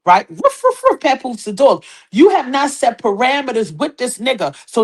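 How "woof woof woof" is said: mock barking. Imitating dog barking.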